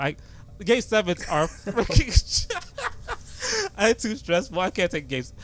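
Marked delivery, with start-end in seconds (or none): 2.5-3.7 high whimpering laugh. Real, not acted. Laughing at game seven stress.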